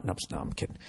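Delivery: whispered aside; whispered retraction. Real, not acted. Comic backing off a joke, whispering. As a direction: take it back under your breath. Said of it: "retracts joke in aside".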